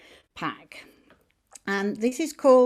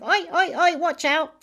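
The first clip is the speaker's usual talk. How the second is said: much higher, cheeky little dog calling out; yapping dog voice; 7 semitones higher, 3 dB louder than usual. Retold or imitated. Tarot teacher voicing the card's dog warning the Fool, high yappy call. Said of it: "the dog is just going".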